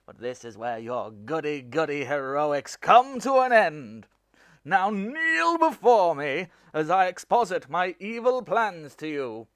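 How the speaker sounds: loud, hammy villain monologue; dark lord. Acted. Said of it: "I wouldn't make a great villain acting like that".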